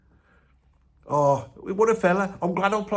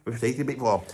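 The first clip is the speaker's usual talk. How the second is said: ordinary delivery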